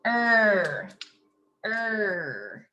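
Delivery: "er" held long, each one separate; isolated sound. Shown as a demonstration. Teacher repeating the word ending, "er" drawn out. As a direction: draw out "er" as a lone syllable. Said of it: "I wanna add er".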